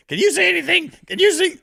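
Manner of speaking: high, loud imitation of a panicked driver; retold frantic yell. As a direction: shout the question in mock alarm. Retelling driver's frantic question in blinding rain.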